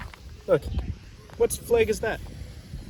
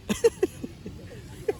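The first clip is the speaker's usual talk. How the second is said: high laugh. Real, not acted. Laughs at the cut-open cat.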